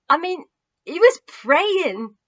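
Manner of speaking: a witness who can't hold back tears; breaking down. Acted. Benjamin breaking down while telling the friends. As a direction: voice cracking high and loud, slowing. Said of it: "He broke down again".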